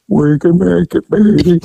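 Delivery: mushy, mouth-full imitation of Charles; mumbled Charles voice. Officer fakes Charles's mumble to fool the lookout. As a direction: mumble like your mouth is stuffed. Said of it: "Charles sounds like he has a pile of shit in his mouth".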